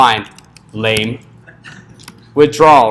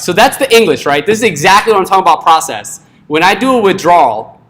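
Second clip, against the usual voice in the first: ordinary delivery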